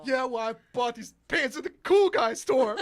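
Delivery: loud, high indignant retort; petulant shouted comeback. Acted. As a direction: shout it like a wounded show-off. GM voices insulted bard.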